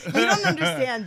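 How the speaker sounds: loud, laughing insistence. Real, not acted. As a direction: say it through laughter.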